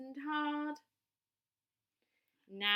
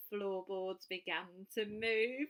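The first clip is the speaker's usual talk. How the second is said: plain reading voice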